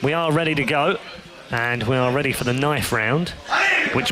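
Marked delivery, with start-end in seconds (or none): none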